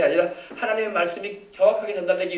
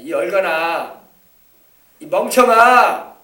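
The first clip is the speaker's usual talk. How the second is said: slow, loud scolding cry; slow, loud name-calling; scolding shout; 9 dB louder, much slower than usual. Retold or imitated. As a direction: drag out each insult like an exasperated father. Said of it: "이 말이에요".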